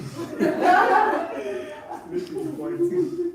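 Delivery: high, free burst of laughter; big laugh. Real, not acted. Preacher laughs at story.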